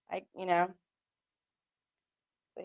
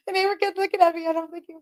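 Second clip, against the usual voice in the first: high, laughing through the words; real giggles breaking the line; 9 semitones higher, faster than usual. Real, not acted. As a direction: crack up while saying it. Retelling it while laughing.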